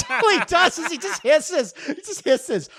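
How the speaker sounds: high-pitched laughing; words squeezed out through laughter. Real, not acted. Laughing about Gollum.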